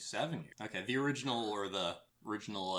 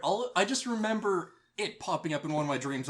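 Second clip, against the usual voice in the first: ordinary talk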